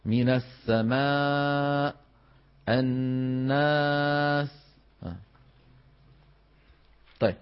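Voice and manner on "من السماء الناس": thin light alif in السماء and الناس; slow recited demo. Shown as a demonstration. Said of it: "وترقق الألف بعد المرقق".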